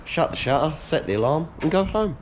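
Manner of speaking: normal talking voice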